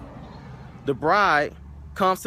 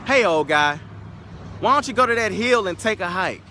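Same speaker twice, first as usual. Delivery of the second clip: mocking taunt; 6 semitones higher, 5 dB louder than usual. Cab driver taunting the old man.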